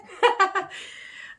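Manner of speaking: loud, high burst of laughter; delighted laugh. Real, not acted. Delighted that she feels good.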